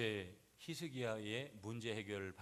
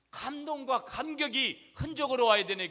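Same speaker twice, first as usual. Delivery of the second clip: much higher and louder, rising cry; fervent preaching shout; 13 semitones higher, 14 dB louder than usual. Real, not acted. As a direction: lift the whole room with it. Preacher's climax. (높고 크게 외치는 설교)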